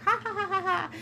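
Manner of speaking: high-pitched acted cackle, ha ha ha; hysterical laughter. Woman showing how she laughs at dumb jokes, hysterically. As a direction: imitate cracking up on the floor. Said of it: "I'm crying and I'm, like, rolling".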